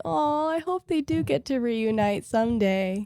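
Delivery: soft, slow, wistful wish; wistful hope. Retold or imitated. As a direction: say it softly, drawing out the hope. Voicing own fond hope for characters.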